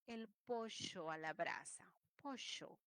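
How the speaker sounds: "pollo" with the ll as sh; "pollo" said as "posho". Retold or imitated. Teacher imitating another regional speaker, "pollo" with sh. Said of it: "Y otro dice".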